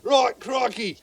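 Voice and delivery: loud, high mock Australian "crikey"; Australian accent. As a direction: ham up an Australian exclamation. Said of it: "try to do a, a, an Australian accent".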